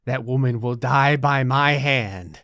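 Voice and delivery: low, slow villain growl for the Sim; menacing Sim voice. Retold or imitated. Gamer voicing Australian man as slow low villain. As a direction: voice the Sim as a deep-voiced killer. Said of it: "He's like".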